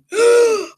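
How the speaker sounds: sharp high gasp of horror; shocked gasp. Retold or imitated. A recruit at her locker finds a gray hair, sharp gasp.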